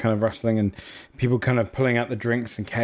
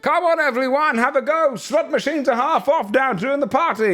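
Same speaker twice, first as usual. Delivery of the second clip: much higher, far-off barker calling the crowd over; Vandervoor. Acted. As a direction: pitch it way up like a showman hawking games. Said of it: "Vandervoor's voice in the distance being like".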